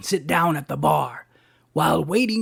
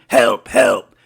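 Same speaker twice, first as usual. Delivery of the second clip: louder cry for help; mock scream; 7 semitones lower, 8 dB louder than usual. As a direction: call out loudly, acting a scream. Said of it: "and screamed".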